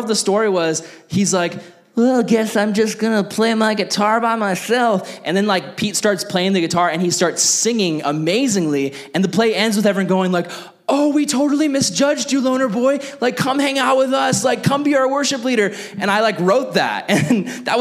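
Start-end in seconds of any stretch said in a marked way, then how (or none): 1.9-5.2 mock mopey nerd. Lonely nerd character speaking in play. 10.9-15.7 hammed-up sudden adoration, much higher; mock gushing crowd. Whole cast cheering nerd in play.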